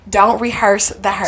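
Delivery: "don't rehearse the hurt" said slowly, leaning on "don't"; slow emphasis. Shown as a demonstration. Giving friends her motto, slow and firm. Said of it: "I don't wanna rehearse the hurt".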